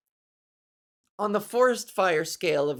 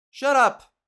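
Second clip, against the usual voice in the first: louder sudden snap; snapped shut up; 6 semitones higher, 8 dB louder than usual. Acted. Telling the shirt guy to stop, loud snap. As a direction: cut him off sharply. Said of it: "And it's like".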